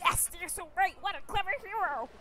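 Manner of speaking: hammed-up praise for a hero; gushing townsfolk. Townsfolk gush over the hero.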